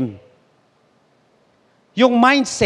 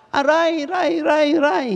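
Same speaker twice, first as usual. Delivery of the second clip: echo; 6 semitones higher, slower than usual. Acted. Echo answering from the mountain.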